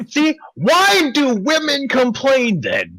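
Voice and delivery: high, slow whiny mock complaint. Mocking women's complaints in a high whiny voice. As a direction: whine it up high and drawn out.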